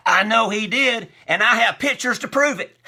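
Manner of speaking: loud, high retort shot straight back; sharp comeback. Retold or imitated. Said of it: "She shot back".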